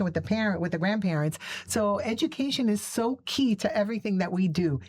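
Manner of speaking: ordinary talk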